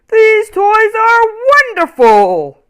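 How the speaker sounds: delighted visitor, drawn out and loud; deep friendly voice. Acted. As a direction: marvel at the toys. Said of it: "in a deep, friendly voice".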